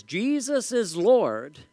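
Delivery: slow, high imitated rally cry. Somebody at a political rally, crying out in imitation. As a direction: proclaim it slowly, pitched high, without a full shout. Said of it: "somebody cries out and says".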